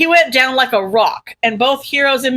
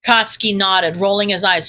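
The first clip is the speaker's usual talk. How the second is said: ordinary delivery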